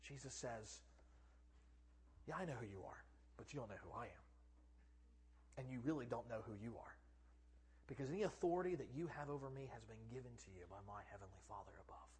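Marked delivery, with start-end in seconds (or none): 2.3-4.2 very low, soft, composed reply; hushed, calm certainty; calm Jesus. Acted. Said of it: "kind of calmly turns and looks at him". 5.6-12.1 very low, soft, composed reply; hushed, calm certainty; calm Jesus. Acted. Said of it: "kind of calmly turns and looks at him".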